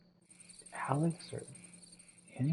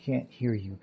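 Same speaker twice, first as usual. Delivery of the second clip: usual speaking voice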